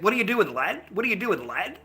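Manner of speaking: playful Irish accent impression. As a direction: imitate an Irish lad's accent. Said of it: "I fucking love that accent".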